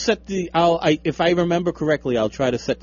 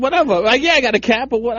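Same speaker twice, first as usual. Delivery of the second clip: dismissive; 10 semitones higher, 5 dB louder, faster than usual. Retold or imitated. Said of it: "Nah, I said".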